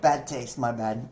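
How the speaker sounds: ordinary talk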